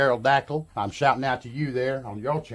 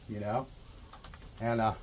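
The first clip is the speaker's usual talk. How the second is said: ordinary talk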